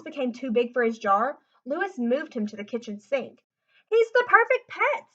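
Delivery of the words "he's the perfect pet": put-on high, loud happy kid; delighted boy. A narrator's reading. Lewis praising his tadpole, high and delighted. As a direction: gush about your pet. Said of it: "said Lewis".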